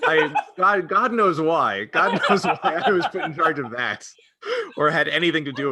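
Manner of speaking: laughing through the words in disbelief. Real, not acted. Laughing at own absurd role.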